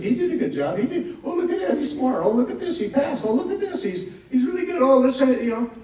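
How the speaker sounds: high, gushing mock praise; mock admiring onlookers. Mocking the crowd, gushing high praise. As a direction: ham up everyone cooing over him. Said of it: "everybody looks at it and said".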